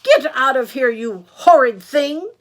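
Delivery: hammed-up fury, stretching the final words; furious Granny. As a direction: yell at the intruder as an outraged granny. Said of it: "Granny was furious".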